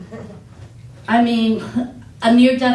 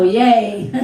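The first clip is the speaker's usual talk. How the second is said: normal talking voice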